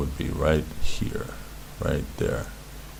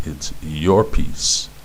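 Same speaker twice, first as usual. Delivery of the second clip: ordinary talk, nothing marked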